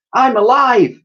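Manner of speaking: high, loud joyful cry; exclaimed. Acted. Elsie cries out, swinging in the air. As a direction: burst out with relief. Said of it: "she exclaimed".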